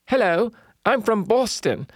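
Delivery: high, lightly mocking Boston accent. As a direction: put on a mock Boston accent. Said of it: "talked with this nasal accent".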